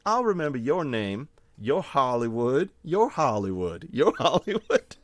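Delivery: hammed-up folksy taunt ending in a laugh; bumpkin teasing. Teasing a man in the crowd, calling him Hollywood. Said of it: "start talking like this".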